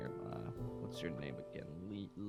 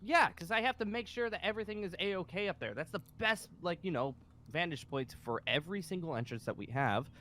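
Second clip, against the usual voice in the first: heated, raised-pitch tirade; angry; 7 semitones higher than usual. Acted. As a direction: vent angrily in self-defence. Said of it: "So you sound angry".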